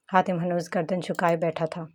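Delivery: ordinary delivery, nothing marked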